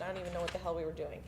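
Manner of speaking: usual speaking voice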